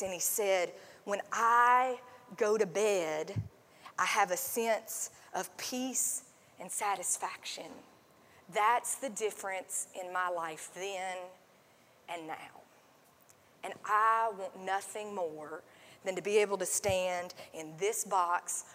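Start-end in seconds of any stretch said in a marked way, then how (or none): 1.0-12.6 slow, lower and softer, calm and wise; deep content wise voice. Retold or imitated. Pastor imitates the podcast man, lightly voiced slow calm sage. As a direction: speak like a wise old man at peace. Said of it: "this deep, content, and wise voice".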